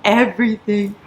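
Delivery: choked up over a book ending; crying happily over the last page; emotional crying. Real, not acted. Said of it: "Why am I emotional?".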